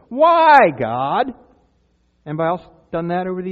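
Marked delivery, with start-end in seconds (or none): 0.1-1.3 loud, high, stretched acted cry; anguished appeal to God. Struggling believer questions God, loud pleading cry. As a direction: wail the why, then drop on God. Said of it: "you sit there and you ask God".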